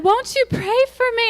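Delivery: very high, loud syrupy plea; gushing blogger. Retold or imitated. Asking followers for prayer, high syrupy mock plea. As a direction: mimic her sweet request. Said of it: "she said".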